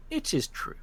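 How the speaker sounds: high, soft reply to the judge; bird-like Reeve. Acted. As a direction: answer as a small bird-headed spirit.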